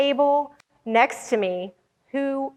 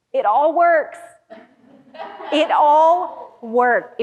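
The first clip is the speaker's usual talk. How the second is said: higher, louder, drawn out in amazement; triumphant relief; 5 semitones higher, 5 dB louder, much slower than usual. Real, not acted. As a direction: savor the moment it finally works. Relief when button works.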